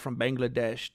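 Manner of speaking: normal talking voice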